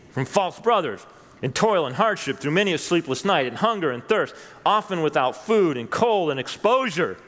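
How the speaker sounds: building fervour. Acted. Preacher reading Paul's sufferings to the church, fervent climax. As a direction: pile each hardship on with mounting fire.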